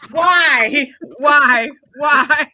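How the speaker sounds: loud, high cries of why; helpless, hollered disbelief; laughing outcry. Real, not acted. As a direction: wail it loudly through laughter. Reacts laughing to a rhymed threat.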